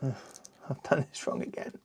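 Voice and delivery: soft, muttered under breath; breathy muttered annoyance; muttered to self. Real, not acted. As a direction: mutter it to yourself. Frustrated at a building mistake.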